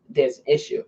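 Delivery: ordinary talk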